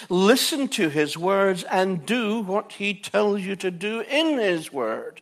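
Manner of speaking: loud, high preaching emphasis. Acted. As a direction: preach it out with force. Preaching at full force.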